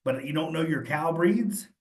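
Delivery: ordinary talk, nothing marked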